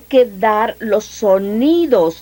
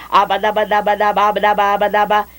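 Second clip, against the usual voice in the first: babbled syllables. Shown as a demonstration. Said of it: "si comienzan así con".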